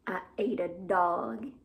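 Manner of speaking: deep, slow little worm; low worm voice. Acted. Voicing Herman the Worm.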